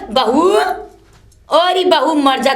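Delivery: ordinary talk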